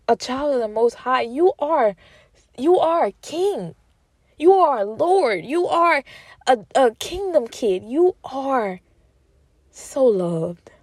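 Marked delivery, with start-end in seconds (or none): 2.6-7.8 rising passionate declaration. Real, not acted. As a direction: proclaim it like a preacher lifting the crowd.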